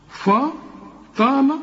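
slow, high, thickened fa in فطال; wrong heavy faa. Shown as a demonstration. Teacher demonstrates what will not do, heavy faa in فطال. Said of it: "تنفعش".

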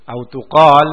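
ordinary talk

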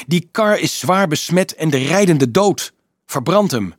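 high, loud, emphatic warning; angry snapping. A narrator's reading. Dolf demands that the contaminated cart be burned, angrily snapping. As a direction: drive the warning home angrily. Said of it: "snauwde hij".

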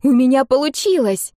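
put-on high girlish exclamation; delighted surprise. A narrator's reading. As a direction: announce it with amazed pride. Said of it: "удивлённо улыбнулась она". (радостно удивлённая девочка)

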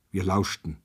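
usual speaking voice

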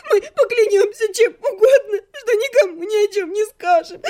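high, sobbing wail; bawling. A narrator's reading. Captive begging to be let go, crying hard. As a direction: beg through sobs, pitch right up. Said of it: "разревелась я".

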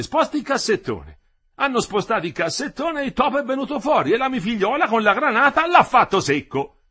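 animated high storytelling, rising to a loud punchline; lively worker telling a parable. Acted. Quinto telling the men about the mouse trap, animated. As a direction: spin the anecdote, pitch climbing as it goes.

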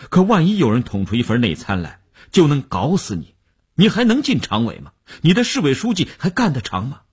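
high, pressing rhetorical questions; urgent warning. A narrator's reading. Wu warns Mei about his career, insistent and alarmed. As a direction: drive the threat home with climbing pitch.

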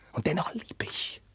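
high, broken whisper. Acted. Carlos admitting his love to Roderich, whispered. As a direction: drop to a breath after the storm. After the outburst.

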